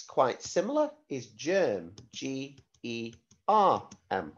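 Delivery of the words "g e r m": spelling out letters. Shown as a demonstration. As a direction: spell each letter clearly for the class.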